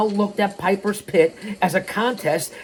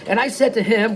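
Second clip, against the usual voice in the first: ordinary talk, nothing marked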